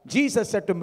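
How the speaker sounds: ordinary talk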